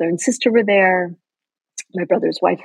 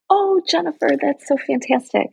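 bright mom voice; 7 semitones higher than usual. Retold or imitated. Mom's delighted reply, retold.

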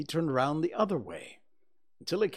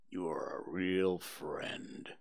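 low, slow, whispered and mournful; gloomy donkey Eeyore; 6 semitones lower, 7 dB quieter, slower than usual. Acted. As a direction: sigh it out like a sad old donkey.

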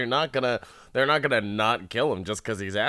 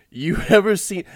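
loud, high, hammy guard; guard's line read; 9 semitones higher, 8 dB louder than usual. Acted. Guard remarks on the condemned man, hammy loud line read. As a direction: overact it as the amazed guard. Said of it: "I really love the guard's line read".

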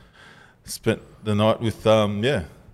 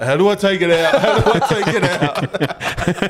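mock panic; 8 semitones higher, 3 dB louder than usual. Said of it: "He's like".

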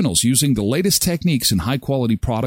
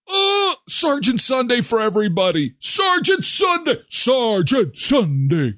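high drawn-out hype shout; hyped shout; 10 semitones higher than usual. Pretend. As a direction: whip up the crowd. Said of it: "that's enough".

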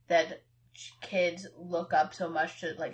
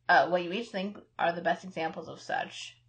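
ordinary delivery

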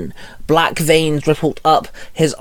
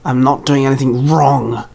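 understated defensive growl. A narrator's reading. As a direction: growl it lightly in protest. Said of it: "Charlie growled".

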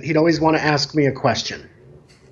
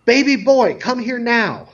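high mock yell of a demanding kid; retold child's yell; 7 semitones higher than usual. Boy yells for his mother's friend from afar, imitated high call. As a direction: imitate a little boy summoning an adult. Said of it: "he yells".

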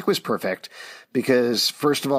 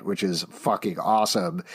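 ordinary talk, nothing marked

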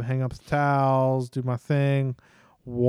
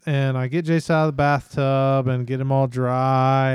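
ordinary delivery

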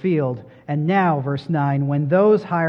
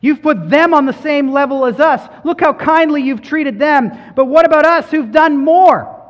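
louder, higher aggrieved protest; grumbling workers; 9 semitones higher, 7 dB louder than usual. Retold or imitated. All-day workers complaining to the master, indignant. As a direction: protest like a worker who feels cheated. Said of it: "they say".

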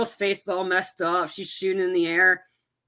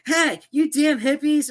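higher-pitched, drawn-out mock yell; retold yelling; 9 semitones higher, 4 dB louder than usual. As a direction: imitate an irate man yelling at hippies. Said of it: "The guy that just yelled at me".

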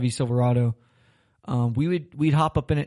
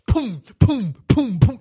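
punchy, higher-pitched booms; imitated impact booms; 7 semitones higher, 6 dB louder, much slower than usual. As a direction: act out each slam with a separate boom. Imitating hits against the bed.

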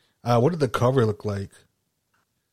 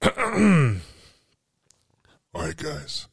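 high, loud then hushed eerie voice; creepy voice; 7 semitones higher, 4 dB louder, much slower than usual. Acted. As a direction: spook the listeners with it. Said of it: "my creepy voice again".

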